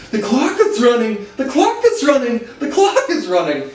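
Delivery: high-pitched hammed-up yelling; mock parents yelling. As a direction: imitate frantic sports parents yelling. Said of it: "they're yelling at me".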